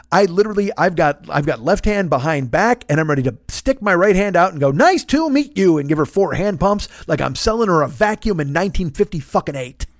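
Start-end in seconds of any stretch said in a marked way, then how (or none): 4.7-5.8 slow, high, over-hearty hello; big rehearsed handshake greeting. Acted. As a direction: greet like an eager salesman.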